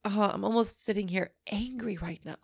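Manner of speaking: normal talking voice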